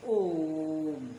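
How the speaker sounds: ओम drawn out very slowly on one held O; long, level ओम closing on the m; prolated vowel. Shown as a demonstration. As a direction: sustain the vowel of ओम for triple length. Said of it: "तीन गुना समय लगता है".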